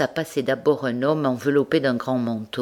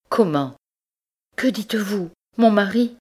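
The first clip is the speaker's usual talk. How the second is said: high, loud stunned outburst; shocked disbelief; 8 semitones higher, 3 dB louder than usual. Acted. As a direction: gasp it out in shock. Wife learns husband's betrayal.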